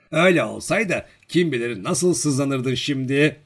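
plain reading voice

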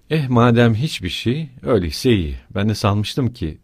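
ordinary delivery

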